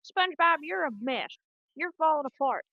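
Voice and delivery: loud, high Texas scolding; cartoon Texan exasperation; Texas squirrel accent. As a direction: tell them off in a Texas twang. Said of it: "the accent's better if your mouth".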